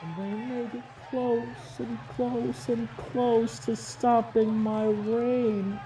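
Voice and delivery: ordinary talk, nothing marked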